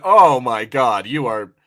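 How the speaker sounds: ordinary delivery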